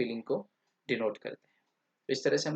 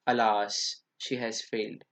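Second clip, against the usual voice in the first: a touch of sorrow, lower; mild sadness; 4 semitones lower, much slower than usual. Shown as a demonstration. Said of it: "alas थोड़ा सा negative sense की".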